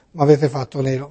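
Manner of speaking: ordinary talk, nothing marked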